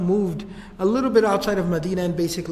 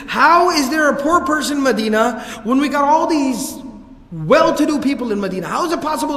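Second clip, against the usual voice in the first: angry outburst; 5 semitones higher, 5 dB louder than usual. Retold or imitated. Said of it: "he would get really angry and upset".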